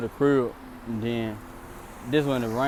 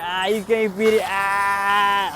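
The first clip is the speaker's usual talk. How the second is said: mocking laugh; 7 semitones higher, much slower than usual. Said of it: "she said".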